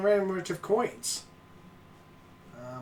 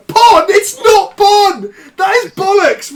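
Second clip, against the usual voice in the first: loud, high, slow shouting; outraged shout; 11 semitones higher, 12 dB louder, slower than usual. Real, not acted. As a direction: bellow the protest. Shouting at correction.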